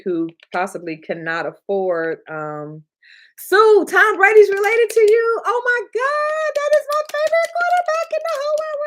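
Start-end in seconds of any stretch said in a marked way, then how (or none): 3.4-8.8 much higher, excited squealing voice; high-pitched fangirl delight; thrilled squeal. Real, not acted. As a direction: gush like a starstruck fan. Reads viewer comment, thrilled.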